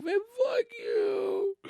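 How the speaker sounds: slow, high whine; sulky kid. Acted. Kid to bragging cousins, high sulky whine. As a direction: drag it out, mock tearful resentment.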